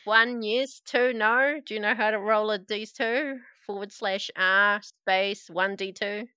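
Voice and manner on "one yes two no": ordinary talk, nothing marked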